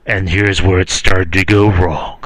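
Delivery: low, slow announcer impression; documentary announcer. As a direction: intone it slowly like a grave TV narrator. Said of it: "you would hear the announcer go".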